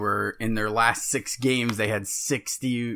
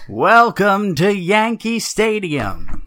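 put-on slow, high, loud old man on the PA; old man announcer; 8 semitones higher, 7 dB louder, slower than usual. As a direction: drag it out like a ninety-five-year-old announcer. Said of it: "Why does he sound like a ninety-five-year-old man?".

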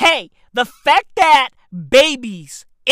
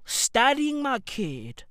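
ordinary talk, nothing marked

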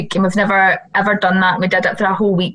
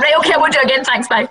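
embarrassed quick agreement; 4 semitones higher, faster than usual. Retold or imitated. Retells embarrassed reply to boss.

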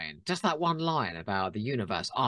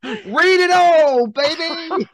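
much higher, louder, slow and stretched; hammed-up Doctor parody; 7 dB louder, much slower than usual. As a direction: ham it up like a camp Doctor. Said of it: "parodying the Doctor's speech".

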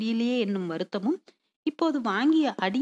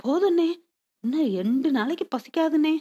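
a touch of alarm, pitched up; flustered refusal; 6 semitones higher than usual. A narrator's reading. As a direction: blurt a hasty refusal, lightly panicked. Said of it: "சிறுவன் பதறி".

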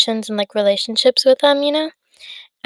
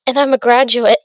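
usual speaking voice

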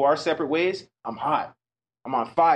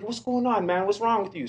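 higher-pitched quoted questions; imitating concerned friends; 6 semitones higher than usual. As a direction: play the puzzled buddies, pitched up. Friends ask what's wrong.

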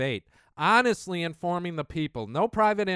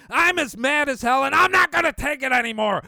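much higher, louder, fast rallying yell; screaming protest; 9 semitones higher, 7 dB louder than usual. Shown as a demonstration. Rallying viewers to scream, higher, louder and fast. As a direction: shout it like a protest chant. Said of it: "We all should be screaming".

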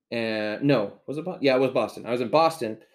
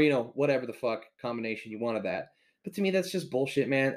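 usual speaking voice

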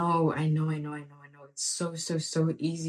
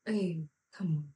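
ordinary delivery

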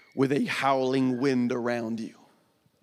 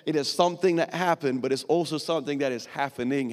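usual speaking voice

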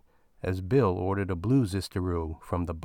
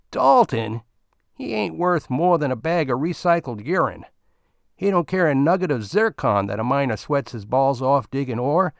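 higher, louder indignant rant; ranting miner; 6 semitones higher, 5 dB louder than usual. Acted. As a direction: rant like a wronged worker. Bill ranting about pay.

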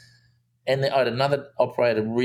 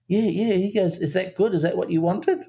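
put-on pitched way up, a light childlike voice; imitating the kid; 10 semitones higher than usual. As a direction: play the eager little boy checking he did well. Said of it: "And he looks at me and goes".

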